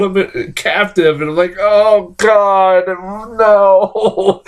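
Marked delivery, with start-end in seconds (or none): 1.6-4.4 raised, drawn-out mock despair; hammed-up horrified reaction; appalled dismay. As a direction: wail it in exaggerated dread. Acts out horror at the premise.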